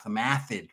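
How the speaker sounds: "acid" said as "athid", s turned to th; light mock lisp, "some athid". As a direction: mimic his lisp on "acid", th for s. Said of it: "That's how he talked".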